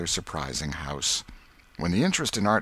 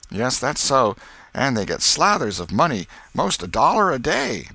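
put-on higher, louder boasting boy; boastful boy Tom; 6 semitones higher, 6 dB louder than usual. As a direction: brag with schoolboy excitement. Tom boasts about circus pay.

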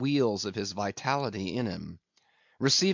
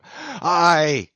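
anguished high, loud wail; half-sobbed, drawn-out cry; loud animal sob; 6 semitones higher, 9 dB louder than usual. A narrator's reading. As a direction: shout it like a wounded beast. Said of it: "he shouted with a terrific, loud, animal sob".

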